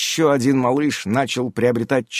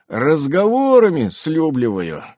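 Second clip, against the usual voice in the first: boasting; 4 semitones higher, 4 dB louder than usual. A narrator's reading. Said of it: "похвастался Иннокентий".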